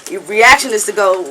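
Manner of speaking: ordinary delivery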